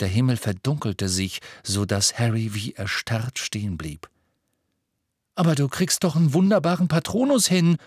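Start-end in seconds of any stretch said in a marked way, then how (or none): 5.3-7.8 high, quick indignant protest. A narrator's reading. Ron objects to breathless Harry about his Patronus, indignant. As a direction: protest in a higher, affronted voice. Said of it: "sagte Ron entrüstet".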